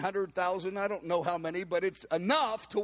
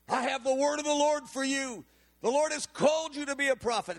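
higher, ringing prophetic declaration; prophesying woman; 7 semitones higher, 4 dB louder than usual. Retold or imitated. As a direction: deliver it as a bold word from the Lord. Elderly woman prophesying to Bob.

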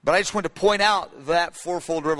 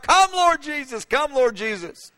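high cry, then slowing down; John laying the pen down to cry out; fervent cry fading into plea; 7 semitones higher than usual. Retold or imitated. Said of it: "he begins to cry out".

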